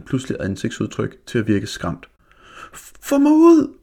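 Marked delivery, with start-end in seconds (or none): 3.1-3.8 shrieked very high and loud, in a panic; terrified howl. A narrator's reading. Jakob stuck in the window, screaming in mock panic. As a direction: howl for help as if something has you. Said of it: "hylede Jakob".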